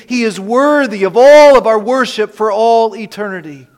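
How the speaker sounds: loud, ringing preacher's climax; real fervent proclamation. Real, not acted. Preacher calling the congregation to worship Jesus, loud and fervent. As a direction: drive it out to the whole room.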